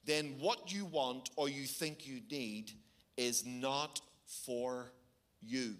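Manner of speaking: slow, weighty preaching; solemn emphatic slow sermon; slow and deliberate. Real, not acted. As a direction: let each word land slowly. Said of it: "I want you to hear this truth very powerfully".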